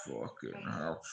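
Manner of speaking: imitating a low, soft muttered curse under his breath; muttered disbelief. Friend reacting to the asylum news, mutters quietly. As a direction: grumble it quietly, dismayed. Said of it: "he was like".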